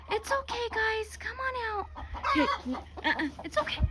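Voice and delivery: gentle high pet talk; coaxing pets. Real, not acted. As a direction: coax scared chickens out. Coaxing frightened chickens.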